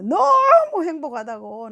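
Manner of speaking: high, loud, long-stretched first word; elated testimony. Retold or imitated. Retelling the wife's dawn testimony, elated. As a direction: gush with drawn-out, overflowing joy.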